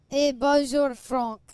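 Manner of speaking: mock French accent, bonjour sung out high and loud; a jokey French greeting; hammed-up French accent. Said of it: "play in French".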